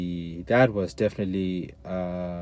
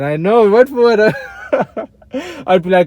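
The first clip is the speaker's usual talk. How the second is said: laughing; 12 semitones higher, 12 dB louder than usual. Real, not acted. Laughing at his own prayers.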